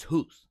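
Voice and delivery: "hoose", rhymes with goose; Canadian "hoose" instead of house. Retold or imitated. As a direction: say "hoose" with a long oo sound for house. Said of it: "it's not the house".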